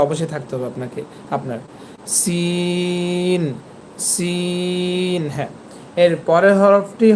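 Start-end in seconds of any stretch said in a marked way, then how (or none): 2.2-5.2 সিন stretched long with a steady s; Arabic letter demo. Shown as a demonstration. Teacher drilling সিন for students. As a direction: draw out সিন twice. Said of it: "এই যে একটা শব্দ আসছে দেখছেন".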